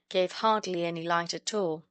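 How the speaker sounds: ordinary delivery, nothing marked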